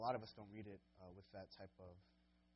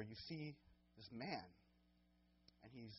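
ordinary talk

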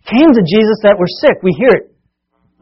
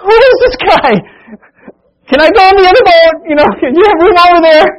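high, loud whiny panic; comic scared disciple; 12 semitones higher, 6 dB louder than usual. Acted. Scared disciple begging for another boat, high comic panic. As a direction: beg to switch boats in comic fright. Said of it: "comic scared disciples".

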